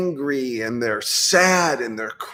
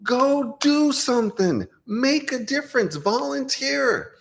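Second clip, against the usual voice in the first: urgent urging; 11 semitones higher than usual. Retold or imitated. Telling woke clients to go volunteer, urgent and high. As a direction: urge them on, voice lifted high. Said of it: "I tell my clients".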